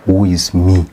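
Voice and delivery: normal talking voice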